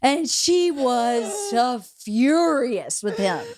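slow, swooping stress on "so furious"; drawn-out emphasis. Real, not acted. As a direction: stretch "so furious" out slowly. Stressing how angry mother was.

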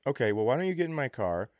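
ordinary talk, nothing marked

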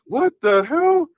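high, slow retold shock; startled what the hell, pitched high.

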